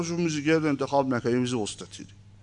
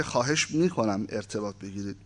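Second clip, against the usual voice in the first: softer and lower, a hushed solemn ask; repeated quiet plea; 6 semitones lower, 8 dB quieter than usual. A narrator's reading. Young man repeating his plea to the spirit, hushed and serious. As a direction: murmur the request again, gravely. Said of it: "کلامش رو تکرار کرد".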